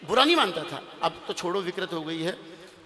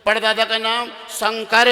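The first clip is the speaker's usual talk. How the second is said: high, loud, slow proclamation; triumphant announcement; 4 semitones higher, 9 dB louder, slower than usual. Acted. Groom dictating to the priest, loud drawn-out announcement. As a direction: stretch the final name out loudly.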